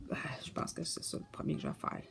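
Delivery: quick whispered aside. Real, not acted. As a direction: drop to a fast whisper, as if to herself. Aside while showing a book. (chuchoté rapidement)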